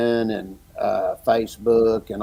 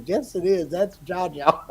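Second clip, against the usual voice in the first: put-on child insisting; 8 semitones higher than usual. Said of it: "She goes".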